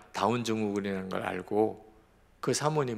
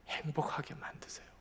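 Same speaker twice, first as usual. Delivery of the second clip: sudden whisper; 4 semitones higher, 11 dB quieter than usual. Real, not acted. Preacher drops to hushed emphasis.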